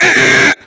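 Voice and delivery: loud, high cry; sudden hammed-up holler; mock window yell. Head out of window, yelling.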